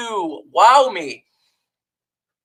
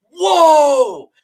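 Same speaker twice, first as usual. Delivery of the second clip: louder, higher mock amazement; sarcastic whoa; 4 semitones higher, 5 dB louder than usual. Real, not acted. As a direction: exclaim in scornful surprise. Reacting to cap savings number.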